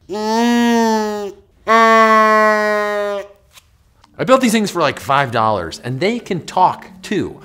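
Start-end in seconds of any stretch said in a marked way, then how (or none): 0.1-3.2 contemplative hum. Shown as a demonstration. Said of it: "Kinda makes you sit back and say".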